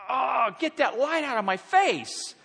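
high, slow, hammed-up complaint; exaggerated irritated groan into words; mock disgusted outburst. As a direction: act out being repelled, drawing it out. Acting out waitress's irritated reaction.

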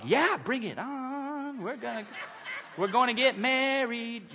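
high, drawn-out excited squeal; hammed-up bridal glee; mock giddy bride. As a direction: stretch the words in giddy anticipation. Acting delighted bride awaiting groom.

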